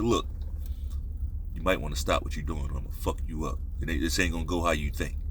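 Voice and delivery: low, hushed warning, kept private; quiet up-close threat. Acted. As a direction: lean in and warn him under your breath.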